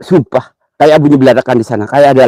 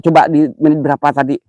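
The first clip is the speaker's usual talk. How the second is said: ordinary talk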